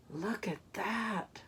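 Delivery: soft, slow aside; hushed awe. Acted. Reader marvels at Stanley's bravery, hushed awe. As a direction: breathe it out in wonder.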